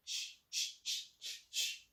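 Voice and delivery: "Ch" whispered, unvoiced and soft; ch like chat. Shown as a demonstration. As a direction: repeat ch as a soft puff of air. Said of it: "This chat".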